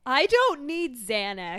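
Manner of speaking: loud, slow dramatic protest; indignant protest. Acted. Housewife refusing to be calmed, loud indignant protest. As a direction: play it indignant and drawn out.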